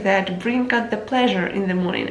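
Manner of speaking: usual speaking voice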